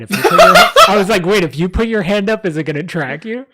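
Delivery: high, loud laughing retelling; bursting with giddy curiosity; excited. Retold or imitated. Retelling his camera idea, high and laughing.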